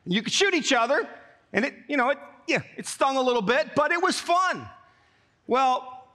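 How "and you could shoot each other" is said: ordinary delivery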